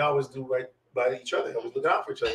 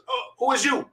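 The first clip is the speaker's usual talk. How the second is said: higher-pitched sergeant's challenge; rude challenge; 9 semitones higher than usual. Retold or imitated. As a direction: challenge a stranger, mock-indignant.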